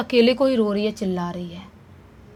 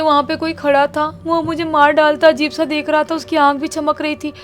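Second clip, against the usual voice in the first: terrified; 5 semitones higher, 3 dB louder than usual. Retold or imitated. Terrified girl woken at night.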